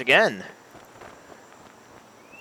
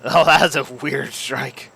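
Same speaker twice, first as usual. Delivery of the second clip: surprised; 5 semitones higher, 7 dB louder, much slower than usual. Real, not acted. Bad golf shot.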